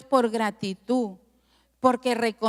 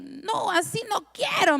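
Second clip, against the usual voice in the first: mock shout; 7 semitones higher than usual. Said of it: "me gritaban".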